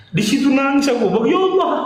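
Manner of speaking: real tearful, high-pitched exclamation; overcome with emotion. Real, not acted. Storyteller recalls weeping at the Prophet's words, overcome. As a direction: voice rising high as tears take over. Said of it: "Aku kalau cerita ini nggak bisa tahan aku".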